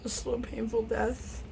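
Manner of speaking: high wail sinking into slow doom; mock scared. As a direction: whimper in hammed-up dread. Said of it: "Be all scared and everything".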